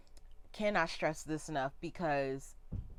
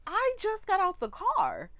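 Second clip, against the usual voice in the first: shocked disbelief; 10 semitones higher than usual. Retold or imitated. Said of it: "and I'm like".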